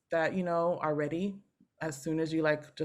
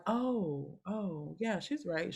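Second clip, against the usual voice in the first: soft, acted-out admission; dawning realization; 2 semitones higher, 8 dB quieter, much slower than usual. Singer at thirty-four conceding teacher was right, quiet realization. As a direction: let it sink in quietly.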